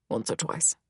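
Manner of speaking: sheepish, very quiet admission; Heinrich. A narrator's reading. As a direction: murmur it like a king caught out. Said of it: "It's rich and deep".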